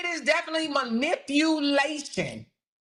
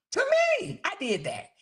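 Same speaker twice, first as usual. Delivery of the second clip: emphatic; 7 semitones higher than usual. Real, not acted. Vlogger jumps high proudly claiming the blame, emphatic. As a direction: leap high on me.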